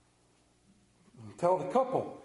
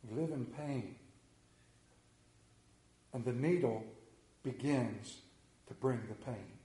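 hushed, lower preacher's voice; hushed and grave; 8 semitones lower, 9 dB quieter than usual. Acted. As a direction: let it sink to a near hush. Sermon drops to hushed tone.

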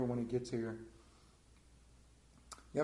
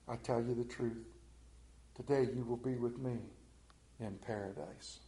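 tender promise; 5 dB quieter than usual. Acted. Preacher voicing Jesus comforting the criminal, soft and tender. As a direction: promise it gently, like comfort to a dying man.

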